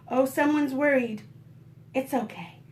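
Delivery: usual speaking voice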